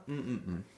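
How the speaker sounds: short hums stepping downward; humming for a blank. Shown as a demonstration. Teacher hiding the fourth word from pupils, humming. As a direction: hum in place of the word.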